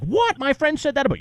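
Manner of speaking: high-pitched mock outrage; mock shock. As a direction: play him caught off guard and offended. Cohost shocked by friends' claim.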